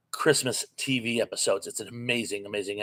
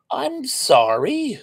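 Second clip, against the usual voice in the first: mock sorry; 14 semitones higher, 5 dB louder, much slower than usual. Real, not acted. Apologizing to a hurt viewer.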